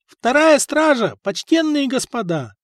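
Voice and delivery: high, loud town-crier call. Acted. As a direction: announce the hour loudly, drawn out at first. Serving boy calls out the hour.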